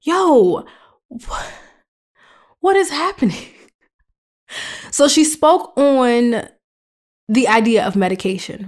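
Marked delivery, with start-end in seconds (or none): 0.0-3.5 mock bewilderment. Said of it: "it was like".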